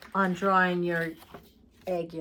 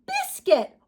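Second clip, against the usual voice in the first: put-on high, loud child exclamation; excited announcement; 5 dB louder than usual. Little girl naming her puppy, high excited cry. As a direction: proclaim the new name.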